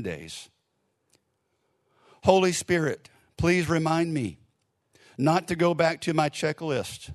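measured prayer with long pauses between phrases; leading prayer. Real, not acted. Leads congregation in prayer.

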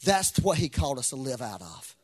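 loud, high, impassioned preaching with a sung lilt; full-force preaching. Acted. Pastor urging the congregation not to live in disappointment, loud and fervent. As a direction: lift the room with rising fervour.

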